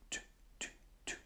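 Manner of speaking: toot, toot, toot whispered without voice. Shown as a demonstration. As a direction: breathe the toots silently. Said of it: "but we're gonna whisper this time".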